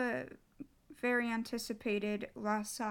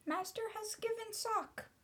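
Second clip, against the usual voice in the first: high, small elf voice full of wonder; wondering house-elf, pitched way up; elf in wonderment; 10 semitones higher, 3 dB quieter than usual. Acted. House-elf marvels at master's gift of a sock, in wonderment. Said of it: "said the elf in wonderment".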